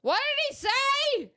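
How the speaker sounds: imitating mom on FaceTime asking what was said; mom's voice. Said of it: "my mom's like".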